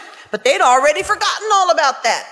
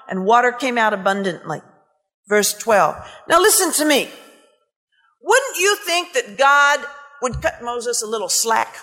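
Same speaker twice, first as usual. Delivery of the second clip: usual speaking voice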